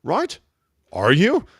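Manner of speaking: a playful New Zealand impression, each phrase ending up like a question; mock Kiwi accent. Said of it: "They end everything on a question like".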